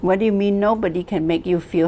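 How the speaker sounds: ordinary talk, nothing marked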